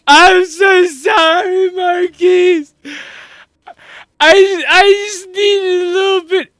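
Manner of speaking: loud, high, drawn-out wail; hammed-up crying apology; bawling in tears. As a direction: keep sobbing over the reassurance. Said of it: "start tearing up again".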